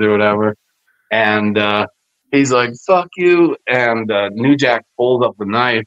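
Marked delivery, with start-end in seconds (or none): 2.8-3.6 retold yelling. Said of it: "he starts yelling at New Jack".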